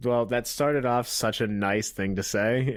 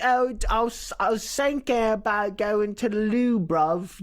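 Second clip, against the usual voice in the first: higher mock British accent, hammed up; put-on British accent; 6 semitones higher than usual. Podcast host riffing a fake British name, mock British accent. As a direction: play a silly Londoner, British accent. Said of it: "I also talk like this, which is the best British".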